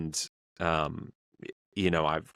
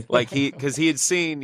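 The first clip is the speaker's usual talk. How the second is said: usual speaking voice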